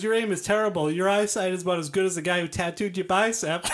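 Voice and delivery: high mocking carny heckle; hammed-up jester taunt; heckling jester. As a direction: roast him like a jester on a stage. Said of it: "He goes".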